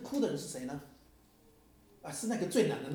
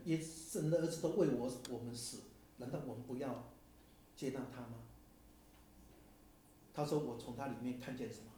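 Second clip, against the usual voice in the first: ordinary talk, nothing marked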